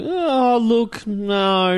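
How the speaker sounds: high, drawn-out hedging no; reluctant disagreement. Retold or imitated. Shop owner answering customers' complaint, reluctant disagreement. Acts out his hesitant reply to customers.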